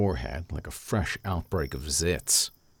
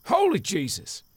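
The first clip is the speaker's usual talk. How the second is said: high, loud shocked shout; startled yell; 5 dB louder than usual. A narrator's reading. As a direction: yelp it out in fright. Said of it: "the loudest".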